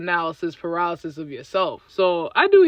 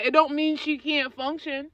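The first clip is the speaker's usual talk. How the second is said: normal talking voice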